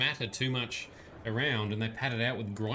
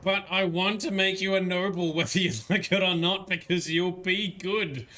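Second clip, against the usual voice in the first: a raised, pressing mock lecture with a laugh in it; mock stern lecture; 5 semitones higher, 4 dB louder than usual. Streamer playfully lectures the noble-shy character, mock stern. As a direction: push the duty speech on her, half joking.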